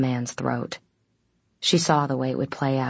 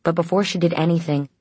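plain reading voice

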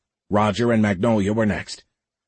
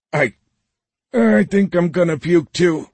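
high, loud, queasy groan; stammered, seasick wail; about to vomit; 9 semitones higher, 4 dB louder than usual. A narrator's reading. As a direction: strain the words out through nausea. Roger queasy in the shaking ship.

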